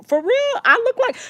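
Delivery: high, incredulous for real; stunned disbelief. Retold or imitated. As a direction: act floored by the question. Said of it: "I'm like".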